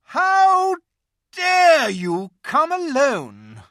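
high, loud, slow furious witch; terrible voice. A narrator's reading. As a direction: thunder at him like a queen. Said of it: "said the witch in a terrible voice".

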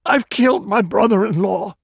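distraught wail. A narrator's reading. Distraught killer confessing.

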